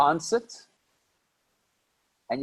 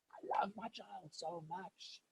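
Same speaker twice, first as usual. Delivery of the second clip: tender hushed; 3 semitones higher, 20 dB quieter, slower than usual. Acted. Speaking of parental love.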